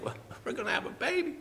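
high, soft, quick wife voice; wife's news. Retold or imitated. Wife tells husband from the bathroom, high and quick. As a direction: blurt the news lightly, high. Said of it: "She said".

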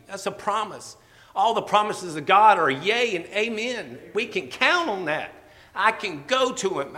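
fervent preaching. Acted. Preacher urging the church to take it to the Lord, fervent. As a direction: lift the congregation with rising fervor.

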